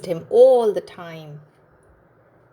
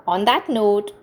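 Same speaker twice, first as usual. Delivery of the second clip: ordinary delivery, nothing marked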